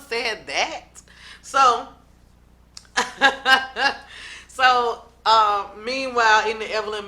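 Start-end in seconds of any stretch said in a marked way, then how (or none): 2.8-4.4 real laugh. Real, not acted. Laughs at the drama.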